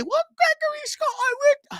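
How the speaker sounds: over-the-top high mom voice, half-shouted; nagging mom voice. Retold or imitated. Mom voice in argument.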